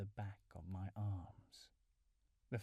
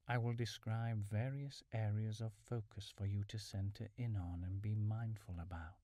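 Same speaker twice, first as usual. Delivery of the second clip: usual speaking voice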